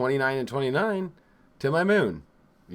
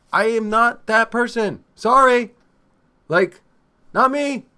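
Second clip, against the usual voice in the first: louder, higher, insistent; emphatic rant; 6 semitones higher, 5 dB louder than usual. Real, not acted. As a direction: hammer home that she won't chill. Ranting about not relaxing.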